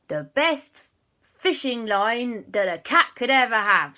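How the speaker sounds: pirate cat voice. Acted. Quasi the cat making his wish, hammed-up pirate. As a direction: growl it out like a swaggering sea cat. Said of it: "next person to make a wish was Quasi".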